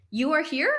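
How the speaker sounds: YOU ARE HERE asked loud and high, HERE shooting up; imitated question. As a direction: retell his question with a sharp rise on HERE in "You are here?". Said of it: "asking me a question".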